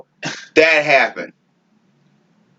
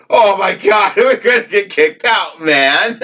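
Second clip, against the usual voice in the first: higher, laughing through a mock panic; giggling that we're about to get kicked out; 7 semitones higher than usual. To his friends at the snack bar, laughing half-panic.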